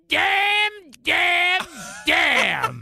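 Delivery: Florida's damn damn damn. Retold or imitated. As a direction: cry it out like a sitcom mother in despair. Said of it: "in the words of Florida from Good Times".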